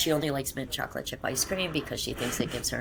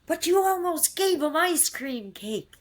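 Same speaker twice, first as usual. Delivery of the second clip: high, slow mimicry of an indignant magistrate; mock screaming; 11 semitones higher, 4 dB louder than usual. Magistrate screaming at a parent over cake, mock outrage. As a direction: imitate an outraged telling-off, pitched way up. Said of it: "is still screaming at me inappropriately".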